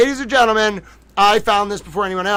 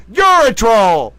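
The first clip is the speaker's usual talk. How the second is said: much higher, louder, slow mock jab; teasing retort; 6 semitones higher, 9 dB louder, much slower than usual. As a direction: poke back playfully. Playful loud retort.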